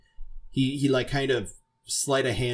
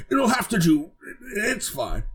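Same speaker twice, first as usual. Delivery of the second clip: put-on orc voice, pitched up; 11 semitones higher than usual. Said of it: "then you hear the orc go".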